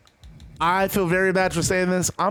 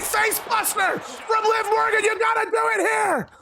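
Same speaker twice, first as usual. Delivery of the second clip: high, hyped shout calling the move; excited commentary; 11 semitones higher than usual. Real, not acted. Gamer calling the move to his viewers, excited shout. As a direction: yell it like a ringside caller.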